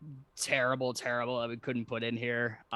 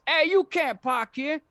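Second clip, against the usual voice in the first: high, loud Italian-guy holler; hammed-up shouted objection; 6 dB louder than usual. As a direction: call it out as an annoyed Italian local. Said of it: "this Italian guy says".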